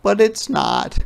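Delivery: put-on a child owning up; high-pitched little confession; girl confessing. Ruthie confessing.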